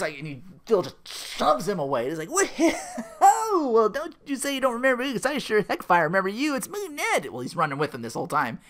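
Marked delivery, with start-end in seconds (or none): 2.3-7.3 Ned. Retold or imitated. Ned reintroducing himself to Phil, high and over-eager. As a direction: gush in a pitched-up, over-friendly imitation.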